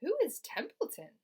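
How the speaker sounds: high, puzzled mother. Acted. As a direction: ask in alarm. Mother voiced.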